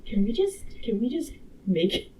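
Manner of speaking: usual speaking voice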